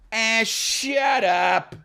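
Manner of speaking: high, drawn-out mock-fifties dismissal; high-pitched theatrical brush-off; hammed-up fifties shut up. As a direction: stretch it out like an old-movie ham. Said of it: "he was doing it like".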